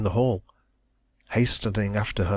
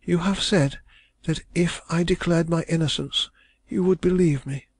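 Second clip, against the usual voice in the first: put-on distressed young woman; 8 semitones higher than usual. A narrator's reading. Young woman protesting her love, high and anguished. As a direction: cry it out as the wronged heroine. Heroine's voice.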